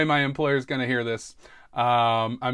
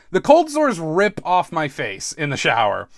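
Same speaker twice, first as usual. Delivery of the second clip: ordinary talk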